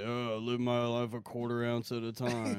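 lazy, low, half-baked stoner; stoner Vin Diesel. Retold or imitated. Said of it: "what if Vin Diesel was a stoner".

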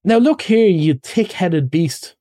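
high, heated, drawn-out insult; rather angry. Acted. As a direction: lay down the law to a friend. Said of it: "really rather angry".

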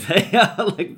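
laughing while saying it. Real, not acted. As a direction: giggle through the words. Laugh mid-sentence about Norway.

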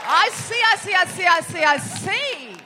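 milk it with a knowing repeat; knowing I see. Real, not acted.

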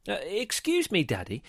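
put-on high-pitched, polite little boy asking; polite inquiry. A narrator's reading. John interrupting his daddy with a question, polite inquiry. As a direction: politely get Daddy's attention, starting high. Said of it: "he would politely inquire".

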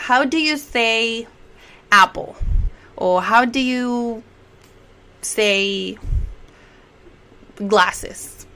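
drawn out, paused before the example word; slow model phrase. Shown as a demonstration. Teacher gives example questions to learners, very slowly. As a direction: stretch it out for learners to follow.

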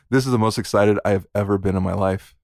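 low, droning imitation of enthusiasm; mock deadpan excitement. Host teasing co-host about her low energy, mock deadpan. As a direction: tease her with lifeless, low-pitched excitement. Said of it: "by the tone in my voice".

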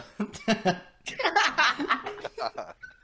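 long, loud high laughing fit; helpless laughter. Real, not acted. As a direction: burst out laughing mid-sentence. Cracks up mid prank call.

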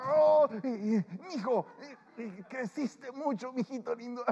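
high, slow, doting exclamation; gushing hugger. Retold or imitated. Preacher imitating an emotional churchgoer cooing over a grown son, high and slow. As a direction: coo at him like a doting aunt. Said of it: "te abrazan y dicen".

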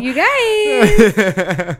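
loud laughing you guys; laughing exclaim. Real, not acted. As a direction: blurt it out through a laugh. Laughing reaction.

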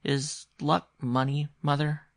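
high, slow hesitant question; timid boy. A narrator's reading. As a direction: ask timidly, in a lightly voiced child's voice. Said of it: "he asked rather timidly".

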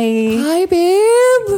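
bright flirty call-out; playful high greeting. Acted. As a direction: squeal the word up high. Calling out playfully to Corey.

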